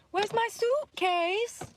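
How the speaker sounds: put-on high, drawn-out little-kid Slovenian accent; Barron Trump. Imitating the viral clip of Trump's son, accented child voice. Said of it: "He's got his mama's accent".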